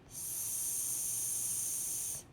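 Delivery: "S" as a long voiceless "sss"; slow sound. Shown as a demonstration. Teacher models a sound for children, "S" held long. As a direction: hold the sound of "S" steadily. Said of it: "say a sound slowly".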